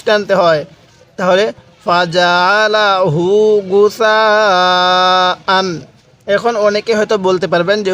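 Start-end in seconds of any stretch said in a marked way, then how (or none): 1.2-5.7 গুসাআন stretched very long; long madd. Shown as a demonstration. Teacher repeating madd example, very slow. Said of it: "চার আলিফ টান দিব".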